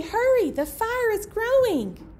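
usual speaking voice

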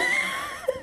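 a sudden high squeal of a laugh; high burst of laughter. Real, not acted. Vlogger laughing at her own pun, high squeal of a laugh. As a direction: let a high giggle burst out.

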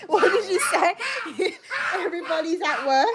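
high-pitched, laughing teasing. Real, not acted. Wife goads partner to talk Aussie, laughing. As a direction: egg them on through giggles.

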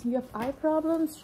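ordinary talk, nothing marked

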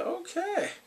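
high startled okay; high surprised okay; taken-aback okay. Retold or imitated. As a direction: squeak it out caught off guard. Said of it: "I was like".

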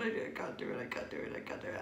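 soft, unvoiced panicked repetition; panicked whisper. Nervous fan waiting to meet Darren, whispering panic. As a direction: whisper it like you're frozen with nerves. Panicking before her turn.

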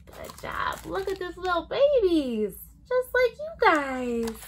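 ordinary talk, nothing marked

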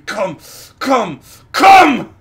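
screamed command. Retold or imitated. Retelling the ASMR roleplay guy, escalating screamed command. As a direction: escalate from a yell to a full scream. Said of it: "starts screaming".

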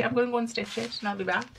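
ordinary talk

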